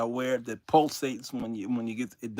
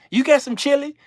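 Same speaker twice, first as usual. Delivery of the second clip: eager asking; 10 semitones higher, 6 dB louder than usual. Retold or imitated. Voicing greedy passenger.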